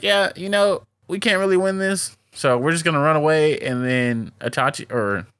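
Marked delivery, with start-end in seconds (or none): 0.0-3.6 high, fast mock villain voice; mock villains giving up. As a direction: play them as silly, higher-pitched quitters. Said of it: "Itachi and Kisame is just like".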